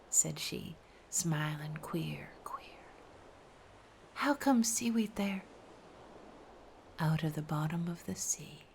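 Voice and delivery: soft unvoiced riddle exchange; hushed riddles. A narrator's reading. Sailor and sea maiden whispering riddles.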